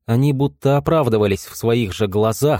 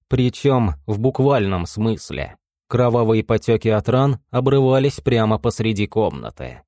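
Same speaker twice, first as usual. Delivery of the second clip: ordinary delivery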